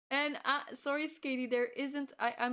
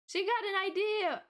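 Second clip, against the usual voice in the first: playful announcement; 10 semitones higher, 5 dB louder than usual. Pretend. Joking about own idea.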